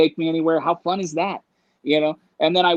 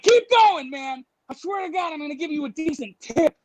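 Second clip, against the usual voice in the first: high, urgent re-enacted plea; frantic pleading; 7 semitones higher than usual. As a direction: beg the driver to keep moving. Pleading with driver while fleeing.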